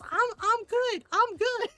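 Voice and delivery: put-on high, loud, insistent kid protest; yelling child. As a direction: imitate a kid yelling innocence. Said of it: "and, right, and yells".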